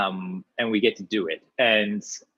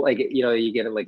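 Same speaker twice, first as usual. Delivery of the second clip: ordinary talk, nothing marked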